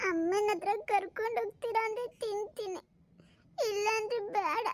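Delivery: put-on whining, tearful little boy; crying child. Boy will eat only for mother, tearful. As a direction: bargain in a child's wobbly wail. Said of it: "ಅಳಬೇಡ".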